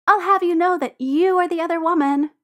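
high-pitched comeback, only mildly hollered; mock-indignant holler. A narrator's reading. Tipsy Maria answering Tyler's teasing, hollering back. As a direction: call it back in playful outrage. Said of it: "Maria hollers back".

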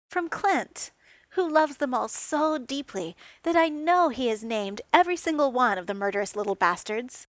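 mock outrage. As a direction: play the wounded drama queen. Tony's mock outrage over Roombas.

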